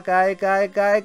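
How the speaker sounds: normal talking voice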